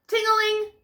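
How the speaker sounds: high sing-song ting-a-ling; bright high bell chime; bell ring. A narrator's reading. Bell sound again.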